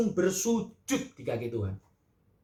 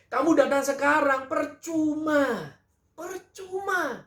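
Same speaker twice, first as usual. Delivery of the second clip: rising reproach; 7 semitones higher than usual. Acted. Preacher voicing Marta's complaint.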